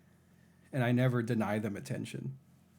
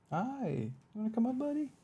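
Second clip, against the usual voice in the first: much higher, sing-song voice for the cat; high pet voice; 14 semitones higher than usual. Real, not acted. Streamer calling her cat in a high sing-song pet voice. Coaxing cat up onto desk.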